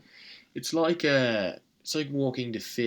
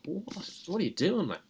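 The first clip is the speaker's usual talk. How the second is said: incredulous; 8 semitones higher than usual. Retold or imitated. Imagining confronting the shooter.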